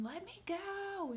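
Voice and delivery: high, slow mock yell of a struggling boy; imitating her son screaming to be let go; a stretched, high retold cry.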